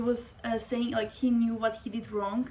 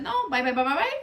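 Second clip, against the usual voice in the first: brisk, dismissive bye-byes, a little louder; dismissive goodbye; 5 semitones higher, 7 dB louder than usual. Retold or imitated. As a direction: wave him off, done with him. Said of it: "So I said".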